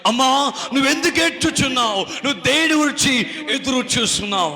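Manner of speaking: high, forceful scripture question; impassioned preaching. Acted. Preacher putting the verse's question to the woman, impassioned. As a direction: declaim the verse with urgency.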